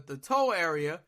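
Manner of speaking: ordinary talk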